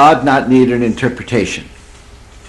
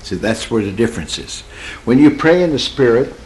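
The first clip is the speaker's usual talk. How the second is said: normal talking voice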